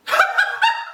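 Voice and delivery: high squealing laugh; squeaky laugh. Real, not acted. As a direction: let out a high giggle. Laugh after rude greeting.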